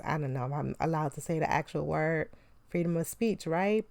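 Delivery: ordinary talk, nothing marked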